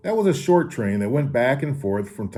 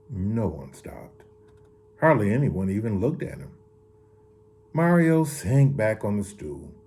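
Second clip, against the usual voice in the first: plain reading voice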